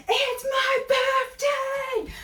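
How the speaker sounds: very high, drawn-out joyful holler; shouting. Retold or imitated. Birthday girl to people along the canal, high shouting. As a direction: yell it out to everyone on the side. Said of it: "I was shouting, screaming, shouting to everyone".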